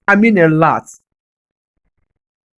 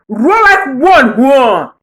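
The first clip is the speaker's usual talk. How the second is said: roaring call; 4 semitones higher, 5 dB louder, much slower than usual. Acted. Urging Africa to roar.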